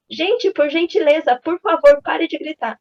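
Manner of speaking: mock teacher shouting. Daughter's teacher asking the class to stop yelling, mock shout. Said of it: "Mas ela tava gritando".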